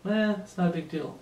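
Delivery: dismissive verbal shrug; mock indifference, opening on a careless "eh"; nonchalant. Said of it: "have this nonchalant attitude".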